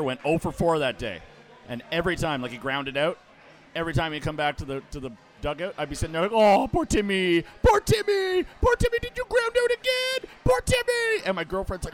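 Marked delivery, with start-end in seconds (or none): 6.3-11.2 high, loud taunting singsong, building; mocking heckle. Fan heckling the player returning to the dugout, mocking. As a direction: taunt him like a pitying child.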